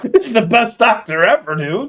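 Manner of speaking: high, loud burst of amused praise; thrilled, far above normal volume; loud delight. Real, not acted. Delighted reaction to played comedy bit.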